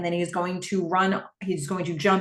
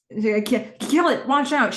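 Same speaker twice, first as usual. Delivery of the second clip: high, raised-voice warning; urgent coaching; 5 semitones higher, 4 dB louder than usual. Acted. Cam coaching Gordon through the level, urgent and high. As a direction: call out urgent gameplay commands. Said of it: "She's coaching him through it".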